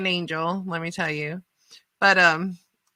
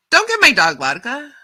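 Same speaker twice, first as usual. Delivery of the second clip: loud, high shout from the upset owner; lady's shout; 6 semitones higher, 8 dB louder than usual. Retold or imitated. Dog owner yelling at the helper, loud and high. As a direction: bark it out like a furious dog owner. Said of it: "the lady jumps up and says".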